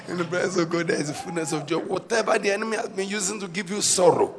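ordinary talk, nothing marked

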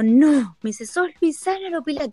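a hint of surprised Chilean shopkeeper; surprised shop owner. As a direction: mimic a shopkeeper's surprised recognition. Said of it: "la señora me dijo".

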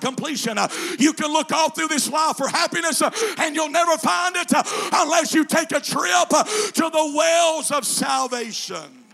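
loud, high, chanted preaching; preaching at full force. Acted. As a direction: whoop it to the congregation.

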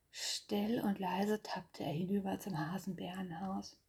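hushed whispered narration. A narrator's reading. Narrator tells Bär sneaking over, whispered. Bear sneaks quietly at night.

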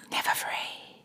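eerie ghostly whisper; spooky whisper. Acted. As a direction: breathe it like a haunting threat. Eerie echo of the line.

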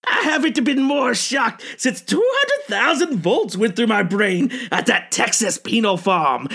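cackling madman horror host; jack o lantern voice. Acted. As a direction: play the unhinged asylum ghoul. Uncle Wiggles creepy host character.

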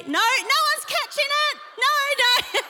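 high, loud cry of mock dismay; animated, high-pitched protest; playful protest. Real, not acted. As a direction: call out to the crowd, high and loud. Mock protest as crowd avoids catching.

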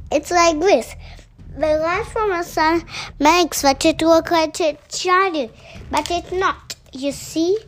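put-on boy hero eagerly setting the captain straight; eager explaining. A narrator's reading.

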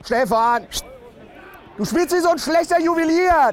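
shrill fan teasing player Andi; shrill heckler. Acted. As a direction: needle him from the stands.

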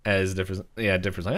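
ordinary talk

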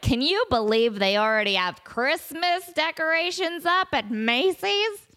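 high, drawn-out mock disbelief; hammed-up boomer indignation; outraged boomer. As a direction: complain like a scandalized boomer, stretching the words. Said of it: "you can't sound more boomer than".

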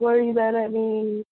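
a touch of tearful pleading; half-hearted imitation of crying; retold bawling plea. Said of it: "like, bawling my eyes out".

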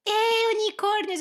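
put-on high, slow, dreamy little girl; girly child voice. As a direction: coo it like a six-year-old. Mocking little girls' excitement. (voz de menina aguda e sonhadora)

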